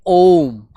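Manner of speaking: ओम drawn out long and held; prolonged om. Shown as a demonstration. Teacher showing kids how ओम is held long. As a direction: stretch ओम like a chant. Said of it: "ओम नाम का उच्चारण करते हैं".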